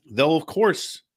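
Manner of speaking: ordinary talk, nothing marked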